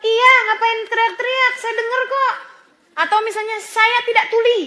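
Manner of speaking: mock-snap back at a shouting child; irritated snap. Mother snapping at a loud child, annoyed. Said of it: "jangan kemudian bilang".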